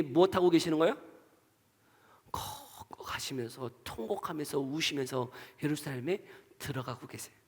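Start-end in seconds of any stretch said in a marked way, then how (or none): 2.3-7.3 much softer, lower, sinking into a hush; grief-hushed preacher voice; hushed and grieving. Acted. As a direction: let it fade to a sorrowful near-whisper. Describing Jesus sobbing into Jerusalem.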